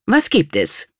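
strict, imperious demand; stern. A narrator's reading. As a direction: answer the interruption sternly. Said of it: "fragte sie streng".

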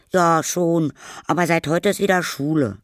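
high, loud schoolboy voice; Paul. Acted. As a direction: report it as a young boy. Boy tells neighbour about school. (hohe, laute Jungenstimme)

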